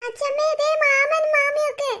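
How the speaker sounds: put-on a small boy calling out to his grandmother; excited child calling. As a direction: point them out like an amazed little kid. Child spots relatives adrift.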